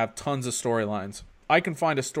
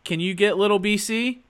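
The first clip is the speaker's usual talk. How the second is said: calm, higher-pitched husband on the phone; 7 semitones higher than usual. Real, not acted. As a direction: keep it real calm for your wife. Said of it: "I like your husband voice".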